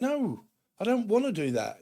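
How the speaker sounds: high-pitched protesting refusal; mock refusal. Refusing a nagging partner in an argument, high mock protest. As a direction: act out digging your heels in. Acted back-and-forth quarrel.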